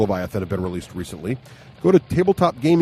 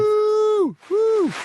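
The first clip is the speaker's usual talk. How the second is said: high whooping cheer; excited cheering; much slower than usual. Real, not acted. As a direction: whoop like a crowd. Cheering the announcement.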